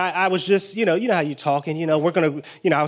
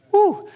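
high sudden woo; preacher's whoop; 13 semitones higher than usual. Real, not acted. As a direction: let out a joyful whoop. Preacher's exclamation.

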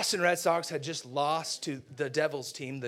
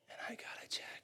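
very soft, voiceless disbelief; wounded whisper; 16 dB quieter, much faster than usual. Acted. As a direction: let it trail into a hurt whisper. Hurt kid muttering over jacket.

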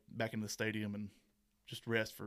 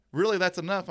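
higher, louder mock disbelief; exasperated guest; 8 semitones higher, 9 dB louder than usual. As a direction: ham up the fed-up guest. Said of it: "they're like".

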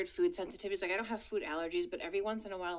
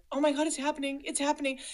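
higher, louder imitation of panic; mock panic; 7 semitones higher, 7 dB louder, faster than usual. Telling cohosts about the road trip, mock panic. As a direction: echo the movie's desperate line. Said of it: "empathize with Maya Rudolph when she's like".

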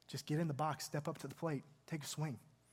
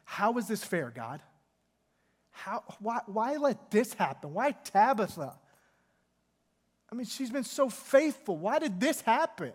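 pitched up and louder, pleading why over and over; a lightly voiced wailing lament; mock wailing plea; 5 semitones higher, 4 dB louder than usual. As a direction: cry out to God like a grieving widow. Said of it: "wailing and just saying".

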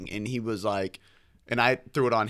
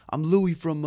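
imitated Italian-American accent, higher and louder; 7 semitones higher, 5 dB louder, slower than usual. Improv partner introducing his deli character, imitated Italian accent. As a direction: punch it out in a put-on Italian accent. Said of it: "he pulls out a Italian accent".

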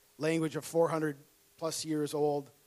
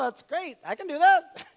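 mock eager; 11 semitones higher, 7 dB louder than usual.

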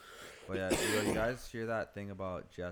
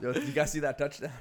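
loud jokey sports-bro voice; jock voice; 5 semitones higher, 7 dB louder than usual. Pretend. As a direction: play a hyped football fan. Mock bro sports talk.

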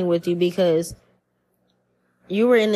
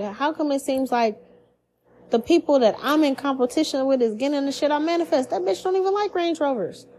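high-pitched indignant griping; much higher, petty mock outrage; envious complaint; 6 semitones higher than usual. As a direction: act out jealous disbelief at a rival. Acting out envy of a rival.

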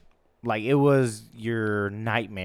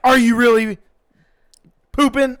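much higher, louder disbelieving teacher voice; incredulous teacher; 11 semitones higher, 10 dB louder than usual. Retold or imitated. Teacher doubting the boy in the stall, high and loud. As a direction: ask it in outraged disbelief.

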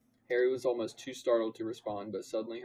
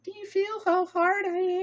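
very high, loud silly voice; absurd character voice; 8 dB louder than usual. Pretend. Reader defying co-host, absurdly high loud voice. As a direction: squeal it way up high. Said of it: "No, absolutely not".

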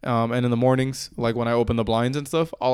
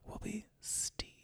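slow, voiceless whisper; hushed, drawn-out mock reverence; dramatic whisper; 8 semitones higher, 23 dB quieter, much slower than usual. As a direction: whisper the name like a grand reveal. Mock-solemn naming of the plant.

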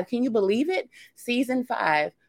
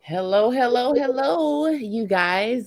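sing-song greeting; 9 semitones higher, slower than usual. Real, not acted. Bright on-air greeting to listeners.

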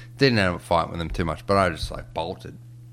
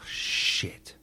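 hushed, softer, drawn-out realisation; retold sinking dread; 6 dB quieter than usual. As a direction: let it sink out under your breath. Acted next-day sinking realisation.

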